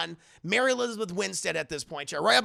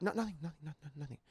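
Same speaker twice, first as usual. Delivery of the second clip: very soft, fading denial; acted nervous murmur; evasive Michelle; 2 semitones lower, 12 dB quieter, faster than usual. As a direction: repeat it quickly under your breath, fading away.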